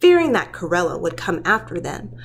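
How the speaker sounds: usual speaking voice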